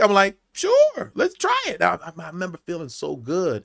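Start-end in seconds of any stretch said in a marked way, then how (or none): 0.5-1.8 very high-pitched playful yes; eager agreement. Retold or imitated. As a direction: re-enact a game, sing-out agreement way up high. Retold eager yes to another take.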